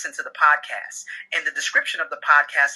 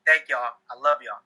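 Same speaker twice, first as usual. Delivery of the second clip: usual speaking voice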